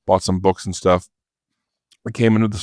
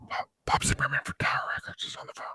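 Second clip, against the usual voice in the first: fast unvoiced whisper, much softer; retold whisper; 6 semitones higher, 12 dB quieter, much faster than usual. Wife whispering the caller's name to Dave, fast hushed whisper.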